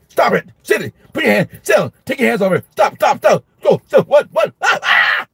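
frantic high commands building into a shrill scream; drill sergeant teacher. Retold or imitated. Overwhelmed teacher to misbehaving kids, drill-sergeant orders breaking down. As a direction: start with sharp orders and unravel into shrieks. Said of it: "So I was a drill sergeant".

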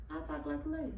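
soft, lightly put-on lady voice; dainty, hushed feminine voice; ladylike voice. Shown as a demonstration. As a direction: promise it softly, like a lady. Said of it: "I won't put on my man voice".

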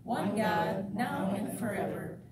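steady, measured public prayer; formal prayer. Acted. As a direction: lead the congregation in prayer, deliberately. Leads the opening prayer.

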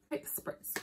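whispered quick aside; whispering. Real, not acted. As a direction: whisper it under your breath. Spraying perfume.

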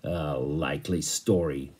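low, slow, sceptical growl. A narrator's reading. Captain doubting the stowaways' excuse, low slow growl. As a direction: growl it in disbelief. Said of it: "growled the captain".

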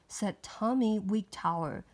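ordinary delivery, nothing marked

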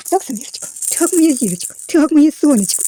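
sing-song motherly endearments; doting baby talk. Pretend. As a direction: coo adoringly to a baby. Cooing to the baby doll.

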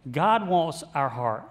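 GOD said slowly, each word weighed; slow emphasis. Real, not acted. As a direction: lean on God, slow and deliberate. Pastor stresses key line.

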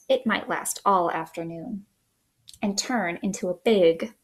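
usual speaking voice

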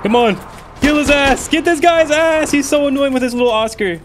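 high, loud excited urging; real excitement shouting at teammates; hyped yelling. Real, not acted. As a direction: cheer them on to the kill.

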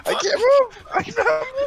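high, loud panicked yelling; panicked shout. Real, not acted. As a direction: yell it in a panic. Stuck in game, panicking.

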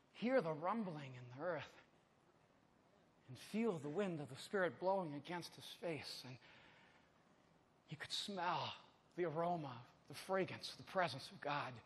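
low, hushed, lingering phrases; quiet awe from the pulpit; reverent preaching. Real, not acted. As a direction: savor each image softly, with long pauses.

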